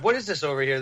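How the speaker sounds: usual speaking voice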